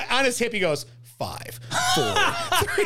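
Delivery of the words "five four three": much higher playful countdown, laughing; kid counting down. Retold or imitated. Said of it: "he does a countdown".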